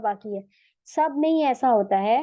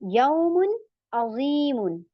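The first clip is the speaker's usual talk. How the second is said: slow, clear tajweed recitation; simple recitation; much slower than usual. Shown as a demonstration. Teacher modelling izhar for students, slow and clear. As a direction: recite यौमुन अजीमुन slowly with a clear n. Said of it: "simple".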